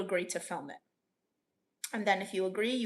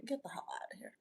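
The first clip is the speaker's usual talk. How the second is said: whispered aside; joking whispered dismissal; under breath; 4 semitones higher, 14 dB quieter, faster than usual. Real, not acted. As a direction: toss it off under your breath. Dismissing an odd request.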